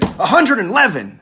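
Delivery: puffed-up manager bragging; proud answer. Retold or imitated. Manager proudly reporting his number to speaker, boastful. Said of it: "He really proudly said".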